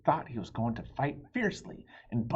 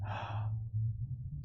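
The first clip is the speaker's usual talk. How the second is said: gasp; 2 semitones lower, 5 dB quieter than usual. Acted. Ladies with flowers.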